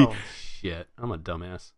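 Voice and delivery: quiet, deflated aside; sheepish realisation. Retold or imitated. Fan admitting he misread the premonition, quiet and sheepish. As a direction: let it drop away softly, owning the mistake. Retold moment of realising his mistake.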